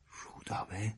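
breathless whispered question. A narrator's reading. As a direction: barely breathe the name. Startled one-word question.

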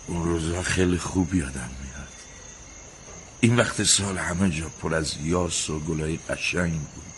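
low, hushed nostalgia; wistful reminiscing. Acted. Husband remembering the flowers that once grew here, low and wistful. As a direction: sink into a soft, low voice of remembering. Willy remembers the old garden.

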